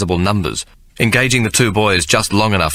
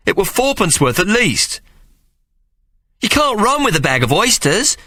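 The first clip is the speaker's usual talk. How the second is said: put-on much higher boy voice, aggrieved; a young lad objecting with a rough street grammar; protesting boy Hawk; 13 semitones higher than usual. Hawk protesting to Mary, high aggrieved boy voice.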